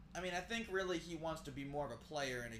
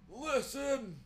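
put-on high, loud old man outburst; 11 semitones higher, 7 dB louder than usual. Shown as a demonstration. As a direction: bark it like a cranky old man. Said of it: "old man voice the whole time".

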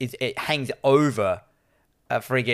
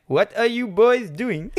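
high, slow mock-German voice; bad German accent; 8 semitones higher, slower than usual. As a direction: Peter Sellers-style comic German accent. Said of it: "the German guy's like".

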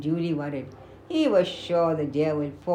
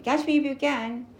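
higher, teasing singsong of a playful deer; mischievous dare, pitched up; naughty teasing; 6 semitones higher than usual. Acted. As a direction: tease him like you're about to dart off. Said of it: "dance away with a naughty look".

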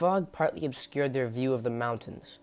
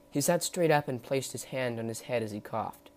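plain reading voice

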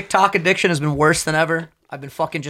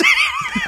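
coffee burp. Real, not acted. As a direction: let out a burp. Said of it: "Sorry, coffee burp".

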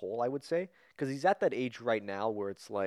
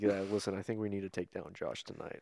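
hushed mob boss ordering a hit; lightly voiced gangster, soft and low-key; 3 semitones lower, 8 dB quieter than usual. Imitating coworker about to order a hit, hushed menace. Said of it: "like, he's about to order a hit".

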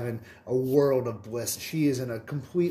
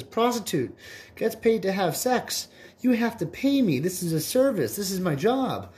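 higher, emphatic reading of the definition; insistent prostitute; 8 semitones higher than usual. Acted. Prostitute reads the dictionary to the koala, higher and insistent. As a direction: jab each word at the koala.

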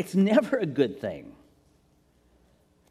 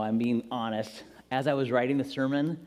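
ordinary delivery

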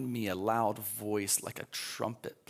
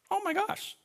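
mock astonishment; 3 dB louder than usual. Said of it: "Thomas didn't".

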